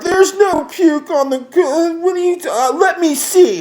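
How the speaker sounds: very high, loud squealing girl impression; mocking the drunk girls. Driver mimics passengers denying the puke, shrill mockery. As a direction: screech it in a mock girly falsetto.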